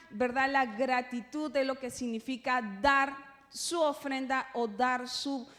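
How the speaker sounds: ordinary talk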